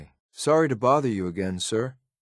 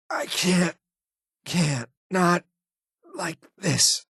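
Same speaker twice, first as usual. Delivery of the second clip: dying gasp; 9 semitones higher, slower than usual. Acted. Dying in combat.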